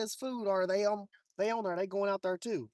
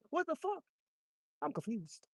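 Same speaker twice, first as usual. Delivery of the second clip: high, startled what the fuck; baffled; 5 semitones higher than usual. Real, not acted. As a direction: blurt it out in shock, pitched up. Baffled at the empty hook.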